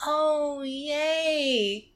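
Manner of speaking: high, soft, drawn-out fake cheer; a weak mock yay; feigned delight. [name]'s feigned delight at snails.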